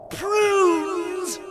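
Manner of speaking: high, puzzled echo, called out; perplexed. A narrator's reading. Algernon questions the housekeeper's odd answer, perplexed. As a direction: repeat the word loudly in bafflement.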